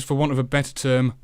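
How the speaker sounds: usual speaking voice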